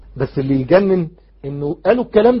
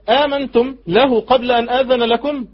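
high, slow recited demand; an outraged ruler questioning his subjects; Pharaoh's challenge; 7 semitones higher, 4 dB louder, slower than usual. Acted. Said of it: "ففرعون قال". (تلاوة بنبرة استنكار عالية)

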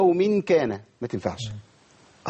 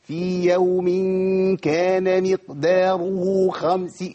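slow, higher mock tajweed recitation; mock overdone recitation; 4 semitones higher, much slower than usual. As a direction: imitate someone straining too hard on the verse. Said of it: "مش في ناس تقرأ كده". (تقليد تلاوة متكلفة بطيئة)

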